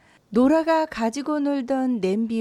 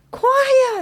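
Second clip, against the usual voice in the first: father's shout; 12 semitones higher, 7 dB louder than usual. A narrator's reading. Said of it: "하고 소리를 질렀고요".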